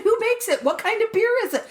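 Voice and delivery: high, excited questions; eager questioning. Retold or imitated. Customer quizzing store guy about glitter beer, excited and eager.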